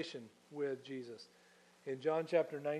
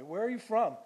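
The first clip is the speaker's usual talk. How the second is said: higher, louder pointed demand; pointed question; 7 semitones higher, 6 dB louder than usual. Acted. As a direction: snap the question at him. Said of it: "Have you ever said that to your teenager?".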